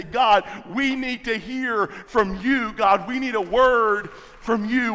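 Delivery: rising sermon intensity; preaching at full force. Acted. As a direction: drive it home to the congregation.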